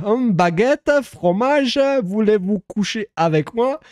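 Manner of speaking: high, sing-song caricature of French; hammed-up French clichés. Streamer insisting on French for a foreign viewer, mock grandiose. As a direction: declaim it like a tourist's phrasebook.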